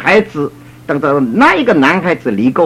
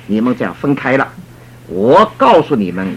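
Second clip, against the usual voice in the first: ordinary talk, nothing marked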